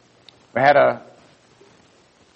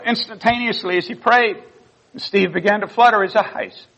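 high-pitched, moved retelling; real emotion lifting the voice high; getting emotional; 8 semitones higher than usual. Real, not acted. Pastor retelling the nurse's witness of prayer, emotional. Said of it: "forgive me if I get emotional".

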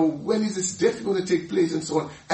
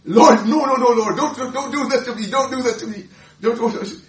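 higher, louder frantic refusal; pleading protest; 5 semitones higher, 5 dB louder than usual. Retold or imitated. Peter refusing to let Jesus wash his feet, loud pleading. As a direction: beg him to stop, loudly. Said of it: "he's saying".

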